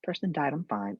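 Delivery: high, light dismissive client; mock breezy denial. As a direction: brush the grief off brightly. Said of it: "I'm like".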